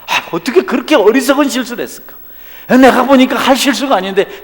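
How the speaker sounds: high, loud, laughing disbelief; pitying disbelief. Retold or imitated. Onlooker scoffing at a churchgoer's failure, laughing. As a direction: laugh at the foolish mistake. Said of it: "내가 보는데 마음이".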